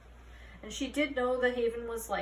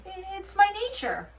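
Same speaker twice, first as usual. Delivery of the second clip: high, stammering whine; whining excuse; 9 semitones higher, 4 dB louder than usual. A narrator's reading. Mulch whining his excuse to Holly. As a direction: plead helplessly, stammering. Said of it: "he whined".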